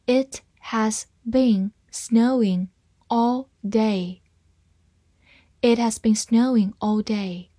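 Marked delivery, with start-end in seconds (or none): 0.1-4.2 slow, one word at a time; slow reading. Shown as a demonstration. Teacher reading the snow sentence for learners, slowly. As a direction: read slowly with pauses between words. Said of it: "好，慢慢来读".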